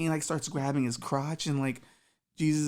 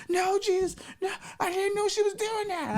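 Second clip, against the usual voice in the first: very high, pleading whine; guy begging Jesus for mercy. Acted. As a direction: whine your innocence.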